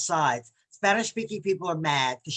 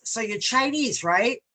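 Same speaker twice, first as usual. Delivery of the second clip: mock presumptuous question; 6 semitones higher, 4 dB louder, slower than usual. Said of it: "the last young lady says".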